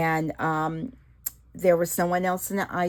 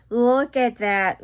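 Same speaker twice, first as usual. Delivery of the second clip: excited; 6 semitones higher, 8 dB louder, slower than usual. Real, not acted.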